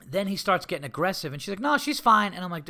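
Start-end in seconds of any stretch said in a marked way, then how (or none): 1.6-2.3 lightly imitated dog owner brushing off worry; higher voice, waving the concern away; a hint of a breezy, unworried owner; dismissive reassurance.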